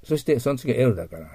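usual speaking voice

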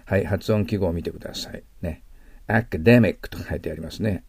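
ordinary talk

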